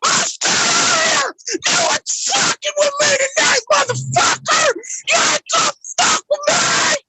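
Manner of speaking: screaming high with rage; furious shout. Real, not acted. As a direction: yell it with cracking fury. Drunken furious stream fight.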